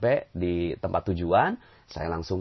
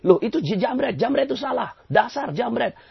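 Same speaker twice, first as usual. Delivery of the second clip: high, raised mock blaming of the thief; mock indignant outburst; 13 semitones higher, 3 dB louder than usual. Preacher mimics someone cursing the snatch thief indignantly. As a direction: play the self-righteous victim, pitch shooting up. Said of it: "Tapi kalau kita bilang".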